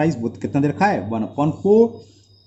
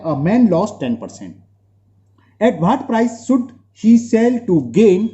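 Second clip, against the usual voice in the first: slow dictation; 4 semitones higher, much slower than usual. Real, not acted. Teacher reads question slowly.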